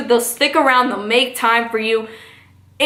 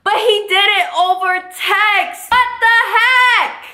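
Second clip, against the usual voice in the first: exasperated disbelief; 7 semitones higher than usual. Real, not acted. Outraged at text breakup.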